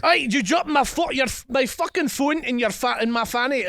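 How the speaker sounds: indignant mock Scottish, stumbling; angrier Scottish accent. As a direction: push the Scottish accent toward anger. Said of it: "way more angry".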